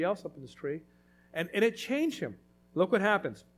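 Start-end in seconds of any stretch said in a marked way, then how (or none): none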